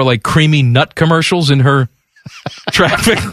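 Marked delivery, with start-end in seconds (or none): 2.3-3.2 laughing. Real, not acted. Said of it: "trying not to laugh".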